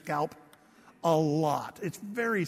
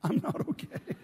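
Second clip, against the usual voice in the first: honest aside breaking into laughter; laughing admission; 8 semitones higher than usual. Retold or imitated. Father admits to himself he is hurt, laughing. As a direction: let the truth slip out through a laugh. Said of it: "And I'm like".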